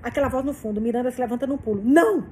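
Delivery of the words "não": sharp loud high no; exclaiming. A narrator's reading. Miranda stopping Frane on the phone, loud exclamation. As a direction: blurt it out to stop him. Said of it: "exclama ela".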